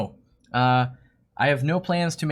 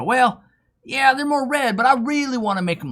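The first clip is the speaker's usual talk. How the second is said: higher, louder put-on voice of a keen hobbyist; imitated hobbyist customer; 9 semitones higher, 4 dB louder than usual. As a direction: play the eager customer pushing for more. Customer voiced asking for redder plants.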